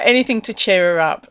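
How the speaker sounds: ordinary talk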